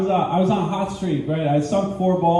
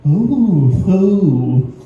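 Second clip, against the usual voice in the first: louder, slow, eerie mock concern; louder creepy feline voice; creepy cat voice; 6 dB louder, much slower than usual. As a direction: purr it like a predator sizing up prey. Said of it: "It's like, a".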